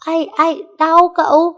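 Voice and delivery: high, loud yelp as her ear is pulled; yelp of pain. Acted. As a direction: cry out ow, my ear. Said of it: "xách cái lỗ tai nó lên". (kêu đau thất thanh)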